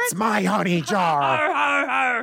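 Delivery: slow, high, drawn-out bear voice; pirate-like bear. Acted. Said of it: "Are all bears pirates?".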